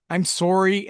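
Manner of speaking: mock Canadian "sorry", said "sore-ee"; Canadian accent. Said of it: "being a Canadian kid".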